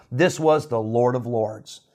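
ordinary talk

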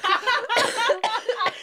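squealing laugh; giggling fit; high burst of laughter. Real, not acted. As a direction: crack up at the joke. Laughing at own story.

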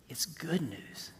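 hushed whisper, letting it land. Real, not acted. Preacher whispering good news to the church. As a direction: breathe it softly to the congregation.

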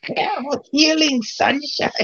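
high, slow witch voice. Retold or imitated. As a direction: drawl it like the witch. Echoing the witch quote.

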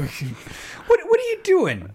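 high-pitched scolding parent voice; ashamed parent. Retold or imitated. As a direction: squeak it out like a disappointed parent. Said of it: "a, ashamed parent".

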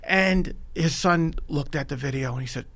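usual speaking voice

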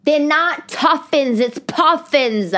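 high, slow, emphatic mock correction; other woman correcting. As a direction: imitate an exasperated friend, stretching each word. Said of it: "the other girl's like".

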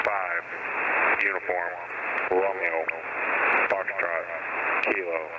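ordinary talk

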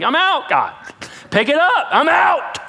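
hammed-up alarm at the snake; panicked mock yell. Congregant dropping the snake, yelling in mock panic. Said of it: "How many of you were like".

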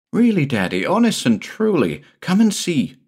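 rising to a crescendo. A narrator's reading. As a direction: build from eager to a high plea. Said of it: "The little boy's voice rose to a crescendo".

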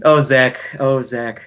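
ordinary delivery

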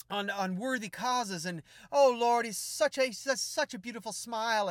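high, loud, gushing prayer that keeps building; fervent intercessor. Retold or imitated. Intercessor gushing over Holyfield, high and loud. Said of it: "she's just going on and on".

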